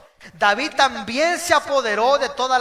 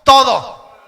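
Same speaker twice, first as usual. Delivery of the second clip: loud call-and-response; 10 dB louder than usual. Shown as a demonstration. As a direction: shout TODO for the crowd to repeat. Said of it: "diga conmigo".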